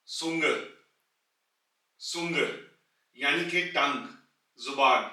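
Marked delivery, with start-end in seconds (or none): 0.1-2.4 सुंग starting with a ts sound; German word demo. Shown as a demonstration. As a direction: say सुंग with ts first. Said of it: "जैसे कि सेट से". (सुंग, शुरुआत में त्स)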